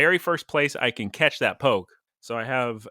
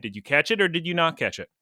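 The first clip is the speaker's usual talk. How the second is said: ordinary talk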